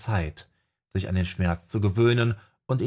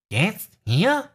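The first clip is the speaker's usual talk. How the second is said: much higher, louder, rising in surprise; startled owl; 12 semitones higher, 4 dB louder than usual. A narrator's reading. Owl asked for a spell right now, startled and shrill. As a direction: squeak it out wide-eyed. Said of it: "Der kleine Vogel machte große Augen".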